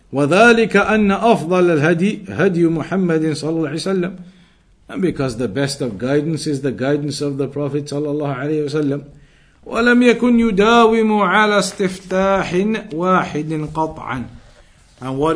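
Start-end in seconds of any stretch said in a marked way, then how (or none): 0.1-4.2 brisk, raised reading of a classical Arabic passage; reciting Arabic text. Acted. Teacher reading the Arabic text aloud to students, brisk and raised. 9.7-14.2 brisk, raised reading of a classical Arabic passage; reciting Arabic text. Acted. Teacher reading the Arabic text aloud to students, brisk and raised.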